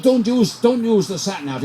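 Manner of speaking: high-pitched attempt at a Brazilian accent; bad Brazilian accent. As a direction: poorly imitate a Brazilian, pitched right up. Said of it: "I can't do a Brazilian accent".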